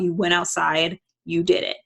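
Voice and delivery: usual speaking voice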